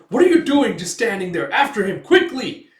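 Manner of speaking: furious order. Acted. Baron yelling at his guards to chase Guts, furious. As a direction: scream orders at useless guards.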